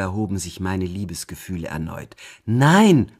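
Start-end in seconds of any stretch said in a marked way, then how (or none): 2.4-3.1 loud, high protesting cry; cried out. A narrator's reading. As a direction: call the denial out at full voice. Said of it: "rief ich". (laut aufgerufen)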